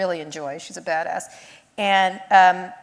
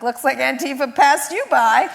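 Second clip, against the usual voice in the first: laughing through a gleeful jab; gloating taunt; 5 semitones higher, 7 dB louder than usual. Retold or imitated. Laughing as she taunts the Facebook group. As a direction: needle them while laughing.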